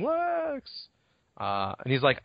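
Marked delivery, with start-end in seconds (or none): 0.0-0.9 high, drawn-out retold yell; mock yelling. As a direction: call the name in a high, held-back mock holler. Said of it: "just yelling".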